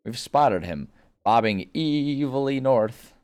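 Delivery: high, slow, hammy superhero voice; cartoon hero voice. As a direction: boom it out like an old cartoon hero. SpongeBob character voice.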